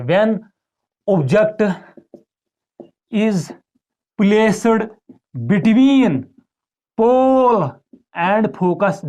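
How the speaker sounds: dictating word by word. Shown as a demonstration. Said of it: "question ऐसे आता है".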